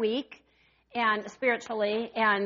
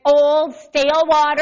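louder, pressing disdain; scornful preaching; 3 semitones higher, 9 dB louder than usual. Acted. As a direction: spit out the stale image. Contrasting stale with living water.

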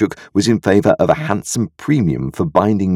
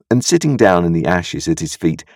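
plain reading voice

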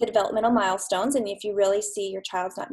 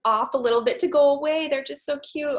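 mock doting mom; 6 semitones higher than usual. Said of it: "I'm just like".

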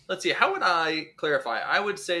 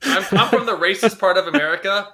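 real laughter, louder and higher; laughing; 5 semitones higher, 8 dB louder than usual. Real, not acted. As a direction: laugh your way through the line. Laughs at his own line.

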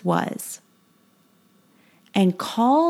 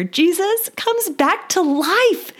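much higher, slow and drawn out, rising at the end; slow joyful announcement; 10 semitones higher, slower than usual. Real, not acted. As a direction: savour "Jesus comes back to life" slowly, lifting on "life". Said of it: "I can't contain it".

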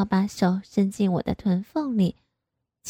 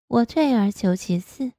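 plain reading voice

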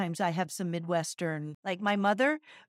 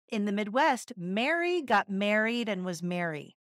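Midwestern dialect. Retold or imitated. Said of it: "I can actually do the dialect".

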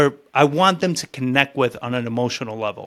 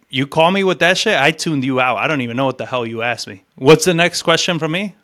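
usual speaking voice

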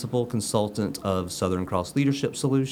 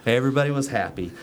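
laughing through the words, faster and louder; 5 semitones higher, 4 dB louder, faster than usual. Real, not acted. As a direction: chuckle it out, quicker and louder. Repeats line, laughing at joke.

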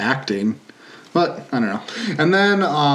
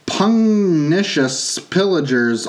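slow; 5 semitones higher, much slower than usual. Acted. Reading a tongue-twister slowly.

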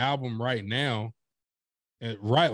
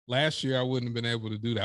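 usual speaking voice

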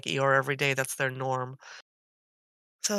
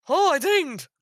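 much higher, louder excited gamer voice; Bricko impression; 11 semitones higher, 5 dB louder than usual. Mimicking a friend's leveling up, excited and high. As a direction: squeal it like a delighted new player. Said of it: "your Bricko impersonation".

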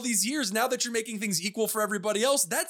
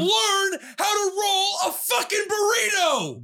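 high, slow, wailing shout; hammed-up outrage, hitting every word; exasperated theatrical yell; mock-angry rant; 8 semitones higher, slower than usual. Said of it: "I'm angry".

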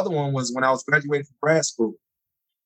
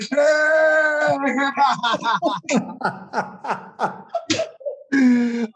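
big real laugh; 10 semitones higher than usual. Real, not acted. Cracking up at his own church story, real laughter. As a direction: laugh out at the memory.

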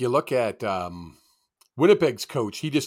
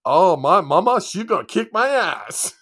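higher, much louder hammed-up scared kid; mock kid voice; 6 semitones higher, 6 dB louder than usual. Retelling the player's answer about his mom, hammed-up mock voice. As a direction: ham up a kid dreading his mama. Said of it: "what happened with that?".

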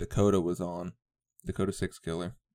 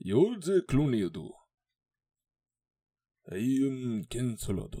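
higher, hammed-up mock Japanese accent; 4 semitones higher, 4 dB louder than usual. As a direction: put on an exaggerated Japanese accent, drawn out. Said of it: "busted out my Japanese voice".

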